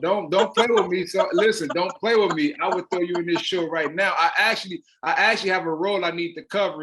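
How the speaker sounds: loud, high, fast and eager; urging her to take the part, all fired up; excited insistence. Real, not acted. Director pressing the host to join the cast, excited and loud.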